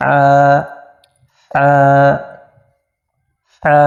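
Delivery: আ caught in the throat, then released; আ from the middle of the throat; throaty ain. Shown as a demonstration. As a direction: hold the sound back in the throat, then let আ out. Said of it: "আওয়াজটা একটু আটকিয়ে তারপর বলতে হবে এইভাবে".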